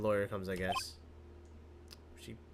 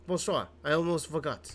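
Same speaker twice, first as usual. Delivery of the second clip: put-on French accent; 5 semitones higher than usual. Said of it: "I get to do a French accent".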